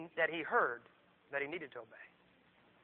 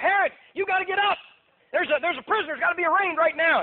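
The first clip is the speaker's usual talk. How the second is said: high, loud urgent call; acted wake-up shout; waking someone up; 9 semitones higher, 7 dB louder than usual. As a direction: rouse a sleeping king in a hurry. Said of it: "They come and wake him up".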